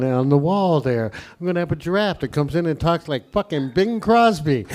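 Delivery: a lightly voiced stoned TV giant, drawled low; stoned Friendly Giant. Comic mocking the Friendly Giant as stoned, in a low mellow voice. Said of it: "he was always talking like this".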